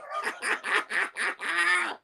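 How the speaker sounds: shrill laugh at own joke; high cackle. Real, not acted.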